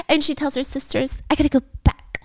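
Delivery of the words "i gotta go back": breaking into an acted whisper; urgent whisper. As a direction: confide it urgently, half whispered. Confiding resolve to her sisters.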